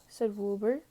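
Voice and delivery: plain reading voice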